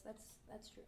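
hoarse, breathy near-whisper; raspy voice. Real, not acted. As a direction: rasp it out quietly. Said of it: "I got a raspy high voice".

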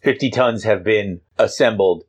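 ordinary talk, nothing marked

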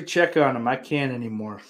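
normal talking voice

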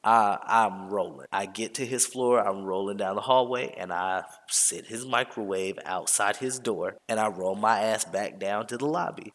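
ordinary talk, nothing marked

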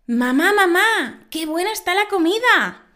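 put-on high, loud little girl calling her mother. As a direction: play an eager child praising dinner. Child voice in a joke.

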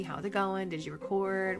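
ordinary talk, nothing marked

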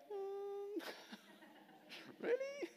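high, quiet, doubtful "really?"; mock doubtful reaction. Girl answering a man's God-told-me proposal, mock doubtful. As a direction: squeak out a hesitant, hammed-up doubt.

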